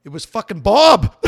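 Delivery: loud, slow punchline shout; high, booming disbelief; explosive reveal. Real, not acted. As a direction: build to the name and blast it out.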